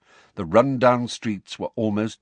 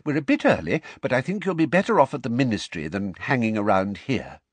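higher, louder, fatherly; Mister Weasley voice; 5 semitones higher, 3 dB louder than usual. Acted. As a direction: steer Harry out kindly. Mister Weasley decides to leave.

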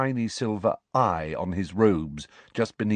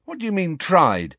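indignant; 10 semitones higher, 3 dB louder than usual. A narrator's reading. Ron's indignant question.

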